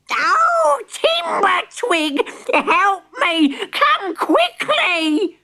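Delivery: very high, drawn-out cry for help; hammed-up panicked granny shriek; piercing scream. A narrator's reading. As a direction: scream slowly for rescue as a granny. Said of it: "a piercing scream rang out".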